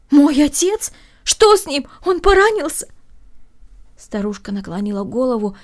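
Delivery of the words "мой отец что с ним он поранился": high, loud panicked questions; frightened questions. Acted. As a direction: fear rising with each question. Daughter hears father hurt.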